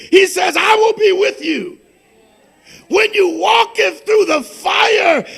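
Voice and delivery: loud, fervent pulpit proclamation; preaching at full force. Acted. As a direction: thunder the scripture over the congregation. Preaching the Isaiah promise.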